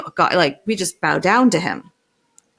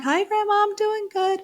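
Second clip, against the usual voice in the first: overly sweet phone voice; 13 semitones higher than usual. Retold or imitated. Her own sugary reply to grandma.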